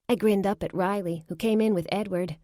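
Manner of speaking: usual speaking voice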